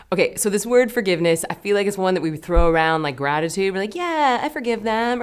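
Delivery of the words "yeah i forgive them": high, loud, glib mock agreement; breezy lip service. Imitating people who throw forgiveness around, glib and high. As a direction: toss it off as empty, sing-song assurance.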